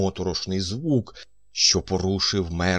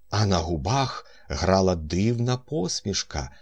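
ordinary delivery

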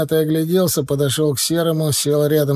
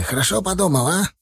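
breathless, gasping question; out of breath; 6 semitones higher than usual. Acted. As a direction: ask it while catching breath. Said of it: "задыхаясь".

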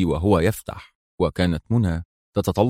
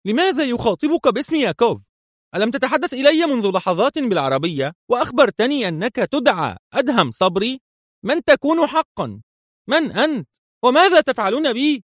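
high, fast, rising panic of questions; frightened woman pleading to know; bewildered outcry. A narrator's reading. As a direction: demand answers in confusion. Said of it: "وهتفت في حيرة".

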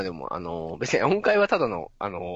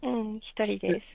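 put-on higher, slow and softer girlish answer; girlish reply; 6 semitones higher, 5 dB quieter than usual. Answering a creepy question in a shy girl voice. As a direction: answer shyly like a young girl.

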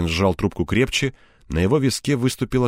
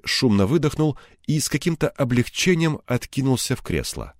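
plain reading voice

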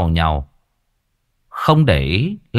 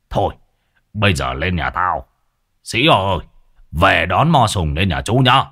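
lower voiced Mo Tùng; 5 semitones lower than usual. A narrator's reading. Mo Tùng speaks as a sober shaman.

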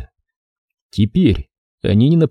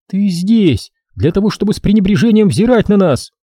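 disgust; 9 semitones higher than usual. Acted. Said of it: "с отвращением выговорила Дренна".

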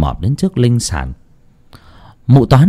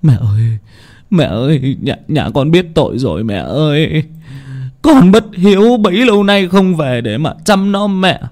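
crying lament; 8 semitones higher than usual. Acted. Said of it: "Ông Tư vừa khóc vừa kêu".

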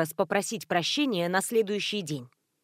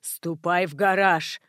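slow monotone, a touch lower; slowed down deep; 3 semitones lower, slower than usual. A narrator's reading. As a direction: drone it like a recording. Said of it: "Монотонно повторил".

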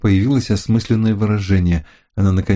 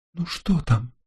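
faint, soft and high as Lucille; barely calling out, drained; weak voice; 6 semitones higher, 6 dB quieter, slower than usual. A narrator's reading. Lucille calls weakly from the car, faint voice. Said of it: "слабый голос Люсиль".

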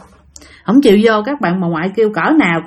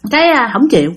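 usual speaking voice

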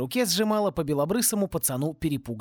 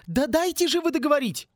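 much higher, louder angry snap; growled; 12 semitones higher, 3 dB louder than usual. A narrator's reading. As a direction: snap at the rector to let her finish. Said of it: "рыкнула МакКонарейкл". (рычит на ректора, требуя договорить)

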